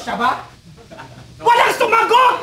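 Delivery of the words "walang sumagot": laughing shout. Real, not acted. Crowd fails to answer.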